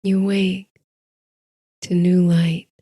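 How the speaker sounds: ordinary delivery, nothing marked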